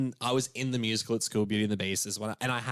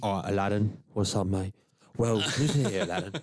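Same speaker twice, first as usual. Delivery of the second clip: gruff British warrior voice; lightly voiced tough guy; Tom Hardy impression; 2 semitones lower than usual. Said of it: "What he'd do is do his warrior voice".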